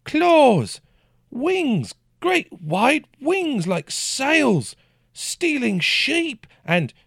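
very high, loud, slow drawn-out cries; frantic shouting. A narrator's reading. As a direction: yell each word like a warning. Peasant keeps shouting about dragon.

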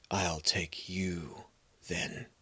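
very slow, soft whisper; horse whispering. Acted. Old horse whispers his promise to the boy, slow and hushed. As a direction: breathe it out like a secret promise. Horse grants wish quietly.

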